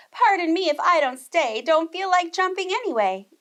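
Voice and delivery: a hint of a cheeky girl leaving; Goldilocks voice.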